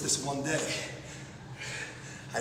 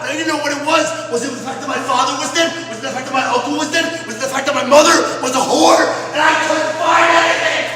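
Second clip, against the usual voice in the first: higher, much louder, pushing through the horror; frenzied loud telling; 7 semitones higher, 10 dB louder than usual. Acted. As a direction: rant it at full volume. Frenzied climax of the tale.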